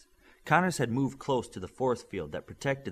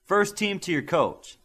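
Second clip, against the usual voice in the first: loud, higher, slow call; yelled; 9 semitones higher, 6 dB louder than usual. A narrator's reading. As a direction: call the team off the field. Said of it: "Spurs yelled".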